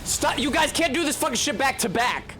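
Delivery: high-pitched indignant complaint; exasperated protest. Real, not acted. Gamer scolding two bosses for attacking back to back, exasperated.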